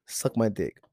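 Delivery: ordinary talk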